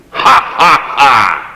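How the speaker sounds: loud, breathy ha-ha-ha burst; forced showman's laugh; demonstrated laugh. Shown as a demonstration. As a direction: bark out a hollow laugh to prove the point. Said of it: "And the same is true of the laugh".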